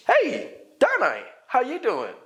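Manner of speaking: high, slow cheery hello to a friend; friendly greeting. Shown as a demonstration. Teacher greets a friend, high and drawn out. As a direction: greet a friend brightly and slowly. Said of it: "It's friendly. It's warm".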